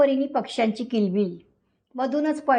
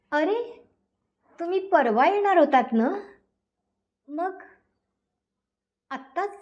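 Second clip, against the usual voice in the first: surprised; 5 semitones higher than usual. Acted. Wife surprised at early return.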